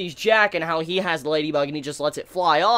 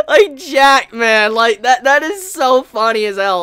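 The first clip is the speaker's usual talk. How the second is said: loud, high, helpless cracking up; laughing too hard to speak evenly; real laughing; 5 semitones higher, 7 dB louder than usual. Real, not acted. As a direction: talk through a burst of laughter. Cracking up at Jack's line.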